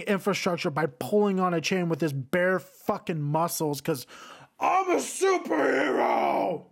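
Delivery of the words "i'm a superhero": high, slow triumphant yell; mock superhero shout. Mocking the movie hero by yelling his boast. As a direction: bellow it like a cartoon hero.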